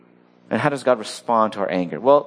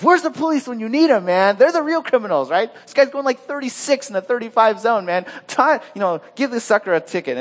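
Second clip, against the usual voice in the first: higher, pushy, self-righteous mock indignation; mock vindictive rant; 10 semitones higher than usual. Pastor mocking his own road rage, hammed-up indignation. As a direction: ham up a petty driver demanding justice. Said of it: "calling for the vindictive justice".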